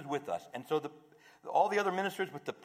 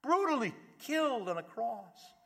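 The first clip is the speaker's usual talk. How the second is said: a touch of the disciples' distress, raised high; grieving disciples; 10 semitones higher, 4 dB louder than usual. Retold or imitated. Disciples telling the stranger their loss, mildly anguished and high. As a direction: pour out the lament, lightly acted. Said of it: "they tell the whole story".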